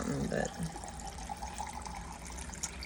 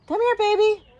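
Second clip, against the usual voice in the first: very high sweet coo; 10 dB louder than usual. Real, not acted. Baby talk to dog.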